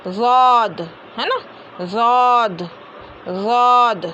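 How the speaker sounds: ज़ौद full and held, tongue side against the molar roots; long rounded "zawd" for ज़ौद; heavy zawd. Shown as a demonstration. Teacher demonstrating tongue placement, heavy pronunciation. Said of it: "ज़बान की करवट को दाढ़ों की जड़ में".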